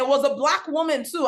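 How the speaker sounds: normal talking voice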